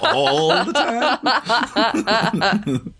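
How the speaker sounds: high laughing squeal. Real, not acted. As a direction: laugh through the words. Laughing echo of question.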